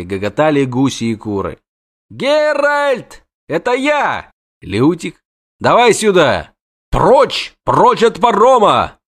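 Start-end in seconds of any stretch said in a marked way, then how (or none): 2.1-6.5 high, excited shout for attention; panicked poet hailing the witcher; calling out. Acted. 6.9-9.0 loud, slow bellow at the crowd; roaring. Acted. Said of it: "Ревел на пристани".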